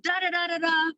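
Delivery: high singsong da da da; mock chatter. Vlogger trailing off mimicking endless messages, high singsong. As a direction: brush off the rest with a lilting da da da.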